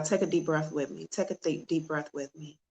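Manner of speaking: very soft, calming invitation; guided breathing. Real, not acted. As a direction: lead them gently through a breath, voice hushed. Leads viewers in deep breathing.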